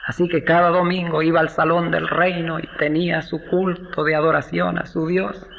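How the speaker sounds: normal talking voice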